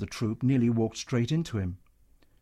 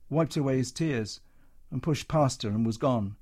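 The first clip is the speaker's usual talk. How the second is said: usual speaking voice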